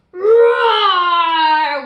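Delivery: huge loud playful roar; monster roar. Acted. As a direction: roar like a giant beast. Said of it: "went yellowest yellow".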